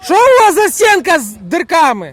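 high, loud, drawn-out complaint; exasperated shout. Real, not acted. Berating players for a wall with holes, shouting. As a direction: yell it slowly at the team.

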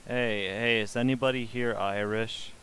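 ordinary delivery

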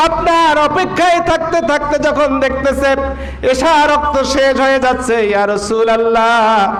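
impassioned sing-song sermon with long-drawn phrase endings; melodic preaching. Acted. Preacher telling the congregation of the Prophet and Ayesha, chanted preaching. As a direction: preach it in a ringing, sustained chant.

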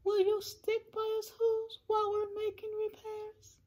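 tiny, very high plea; high little pleading voice. A narrator's reading. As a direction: beg as a small, worried mayor. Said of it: "pleaded the mayor".

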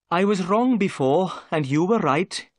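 owning up with regret; sadly. A narrator's reading. Jones admits to Holmes he was wrong, sadly. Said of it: "said Jones sadly".

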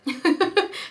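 high short giggle. Real, not acted. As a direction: giggle at your own joke. Laughs at own joke.

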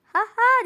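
high, loud cackling laugh; witch cackle. Retold or imitated. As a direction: cackle like a hag. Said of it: "diye böyle cadı gibi gülüyor". (cadı gibi kahkaha)

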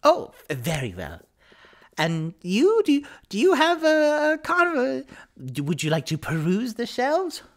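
high, fussing bookseller voice; effusive shopkeeper. Acted. Bookseller offers the customer her shelves, high effusive voice. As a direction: climb high and loud while searching for words.

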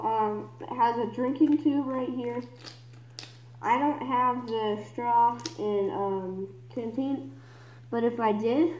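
muffled behind rubber mask; speaking via voice emitter; through a gas mask. Real, not acted. Seen 1: Full gas mask on face throughout; R frames show bare face.

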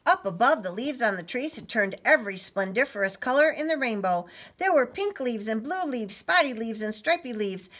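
lively, lilting storybook narration; story reading. A narrator's reading. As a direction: read with wide-eyed wonder to children. Reads story to children.

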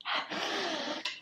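long, quiet intake of breath; soft awed gasp. Real, not acted. Sniffing the gifted candle, soft awed gasp.

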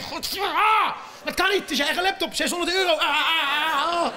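high, strained groaning lament; mock despair. Comedian acting out despair over his own six-hundred-euro laptop. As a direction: whimper over the ruined laptop.

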